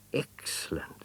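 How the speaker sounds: soft, hushed approval; whispered praise. Acted. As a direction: keep quiet so the jaguar won't hear. Secretly praising, hiding.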